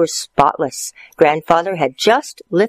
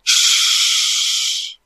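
shush. Acted. Shushing at movies.

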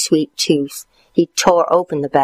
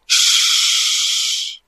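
shushing. Acted.

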